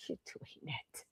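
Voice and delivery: slow hushed cheer; whispered praise. Retold or imitated. Doctor praising her weight loss results, hushed whisper. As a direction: breathe it out like a secret compliment.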